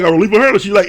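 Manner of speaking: normal talking voice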